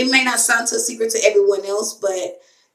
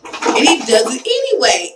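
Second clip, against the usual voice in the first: ordinary delivery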